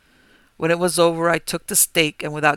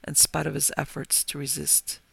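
plain reading voice

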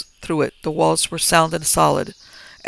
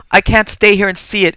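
louder, higher anguished cry; exclaimed; 4 semitones higher, 7 dB louder than usual. A narrator's reading. Landlord cries out as the men dig up the grave, loud anguished exclamation. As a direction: burst out, unable to bear it. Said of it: "exclaimed the landlord once more".

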